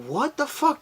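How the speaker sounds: high incredulous what the fuck; exasperated disbelief. Retold or imitated. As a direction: squeal at being stopped. Getting pulled over, retold.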